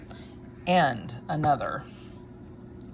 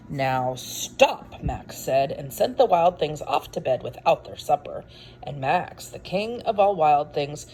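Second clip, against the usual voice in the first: big dramatic read-aloud, louder on the monsters; story narration; 5 dB louder, slower than usual. A narrator's reading. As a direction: build the wild things up for the kids. Reads picture book aloud.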